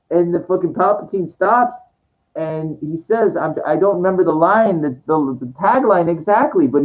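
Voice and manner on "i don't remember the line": ordinary talk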